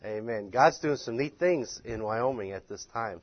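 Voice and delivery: ordinary talk, nothing marked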